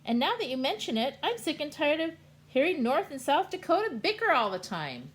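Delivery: fast, high, loud complaint; loud high fast griping; excited rant. Acted. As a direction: rattle off the gripe quickly.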